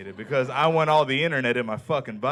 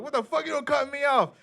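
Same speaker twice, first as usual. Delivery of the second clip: high-pitched indignant yell; angry outburst; 12 semitones higher than usual. Retold or imitated. Driver yelling at the car that cut him off, angry. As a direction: act out road rage, pitch jumping way up. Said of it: "I got real mad at first".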